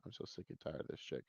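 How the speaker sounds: very quiet, quick whispered grumble; fed-up muttering. Real, not acted. Reviewer sick and tired of her, fed-up muttering. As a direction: mutter it low under your breath.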